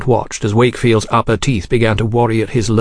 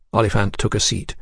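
ordinary delivery

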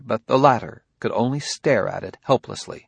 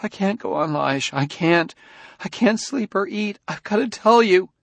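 sobbing; 10 semitones higher than usual. A narrator's reading. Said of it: "through a sudden torrent of sobs in a low voice".

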